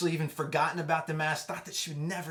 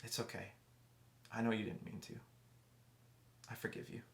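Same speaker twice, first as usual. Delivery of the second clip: softer and softer, ending in a whisper; gentle forgiveness; 3 semitones lower, 11 dB quieter than usual. Retold or imitated. Wife forgiving her husband, soft tender whisper. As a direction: let it fall to a tender whispered pardon. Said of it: "And she goes".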